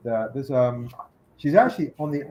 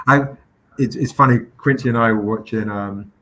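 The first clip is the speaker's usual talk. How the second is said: usual speaking voice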